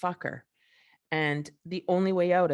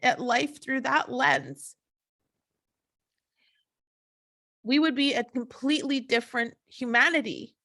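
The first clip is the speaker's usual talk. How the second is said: higher-pitched, emotional appeal; getting emotional; 5 semitones higher than usual. Real, not acted. Wishing everybody would look into their heart, emotional. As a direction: plead from the heart, pausing between phrases. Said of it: "I'm getting emotional".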